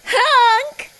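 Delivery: very high, loud goose honk; a sharp goose honk. A narrator's reading. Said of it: "said Goose".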